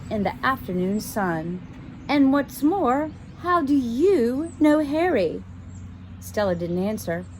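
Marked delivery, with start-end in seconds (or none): 2.1-5.4 put-on haughty girl.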